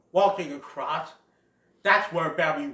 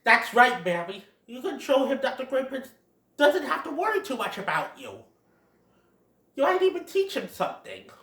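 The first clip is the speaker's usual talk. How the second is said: raised, lightly put-on character voice; encouraging friend; 7 semitones higher than usual. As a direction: cheer a friend on, pitched up.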